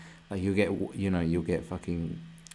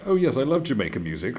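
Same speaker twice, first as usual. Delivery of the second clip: mock poser; 4 semitones higher, 6 dB louder than usual.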